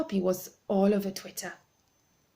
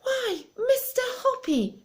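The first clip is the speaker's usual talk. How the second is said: high, slow and drawn-out; surprised cry; slower than usual. Acted. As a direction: let it out in delighted surprise. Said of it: "she cried".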